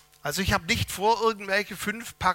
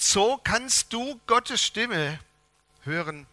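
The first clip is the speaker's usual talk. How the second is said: very slow, each word weighed, "Gottes" carried and falling; slow title announcement; 3 semitones higher, slower than usual. Shown as a demonstration. Preacher announces the sermon title to the church, slow and weighty. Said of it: "der Predigtitel lautet".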